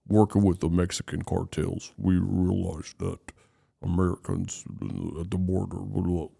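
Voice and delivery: low, soft anonymised witness voice; disguised informant voice. Retold or imitated. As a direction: parody a voice-disguised documentary interviewee. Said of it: "don't want their face and voice to be".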